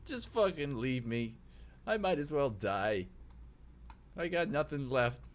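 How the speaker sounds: drawn-out, self-pitying whine; tearful sniffling despair. Acted. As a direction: give up miserably after crying. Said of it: "He, like, sniffs".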